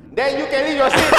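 laughing. Pretend. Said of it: "kalau aku kat passenger dalam".

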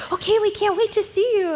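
high, loud, gushing welcome; so excited. Retold or imitated. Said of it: "And they were so excited".

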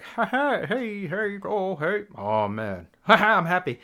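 high, hammed-up buffoon laughing and cheering; big happy buffoon. Showing how he acts around his wife, hammed-up happy buffoon. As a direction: play the loud grinning buffoon. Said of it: "being a big buffoon like me".

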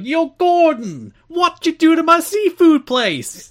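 loud, high, drawn-out Italian American shout; over-the-top Italian American restaurant owner, yelling; Italian American accent. Acted. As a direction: commit fully to a hammed-up Italian American accent. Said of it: "you have to commit to the accent".

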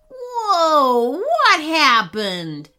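loud dazed robber, slow; dazed surprise. Acted. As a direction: stagger up confused. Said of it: "asked the robber, struggling".

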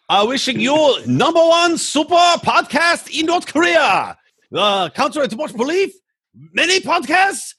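a put-on Korean accent hamming it up as a dictator; fake Korean accent. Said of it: "Korean voice".

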